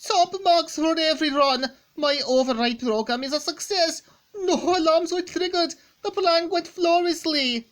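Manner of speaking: gleeful Sterling. Acted. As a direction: crow over the plan's success, laughing. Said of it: "Sterling says".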